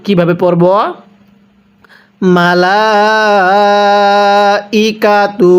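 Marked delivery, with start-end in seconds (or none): 2.4-5.5 মালাইকাতু with the লা held long for four counts; four alif stretch. Shown as a demonstration. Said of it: "চার আলিফ টেনে পড়ব".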